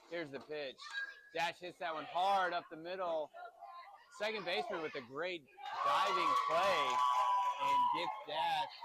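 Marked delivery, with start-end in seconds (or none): 1.3-8.7 louder and much higher, peaking on Dash; excited call. Real, not acted. Dad calling his son's youth game live, excited call. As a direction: call the diving stop with rising excitement.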